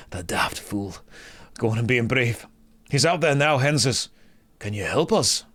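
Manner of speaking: put-on wounded fighter with a Scottish accent. Wounded Gazin asking a paladin for help, Scottish accent. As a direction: play the quest character in broad Scottish.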